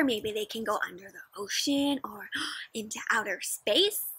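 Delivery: soft wondering whisper. Real, not acted. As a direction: whisper it like a secret. Wonder aside to children.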